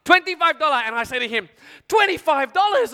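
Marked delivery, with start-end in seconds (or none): 0.0-0.8 high, put-upon market seller; hammed-up haggler making an offer; bargaining shopkeeper. Seller voiced making a special offer. 1.9-2.9 high, loud shocked echo; acted outrage, pitched right up; incredulous buyer. Buyer balks at the price.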